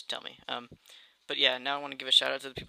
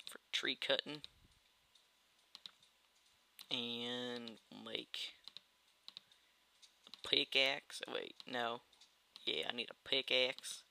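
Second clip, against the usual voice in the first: put-on slow, drawling hillbilly accent; easygoing hillbilly accent, half-conscious; 4 dB quieter, slower than usual. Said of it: "why am I talking in this hillbilly accent".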